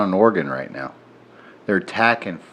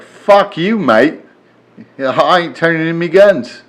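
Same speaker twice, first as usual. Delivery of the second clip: mock New Zealand accent, a bit higher and louder; 6 semitones higher, 5 dB louder, slower than usual. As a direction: play a defiant Kiwi with a New Zealand accent. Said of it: "if that was a horrible New Zealand impression".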